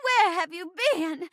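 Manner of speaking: overjoyed bride greeting a missing groom; hammy romantic heroine, relieved and swooning; melodramatic stage bride. Acted. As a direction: play it as a theatrical, lovestruck bride. Plays the bride in a play.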